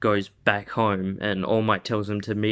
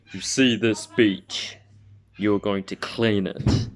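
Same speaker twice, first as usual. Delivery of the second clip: booming mentor voice. Acted. All Might voiced in story.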